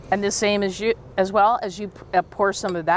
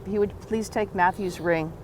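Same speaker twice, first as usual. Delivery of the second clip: ordinary talk, nothing marked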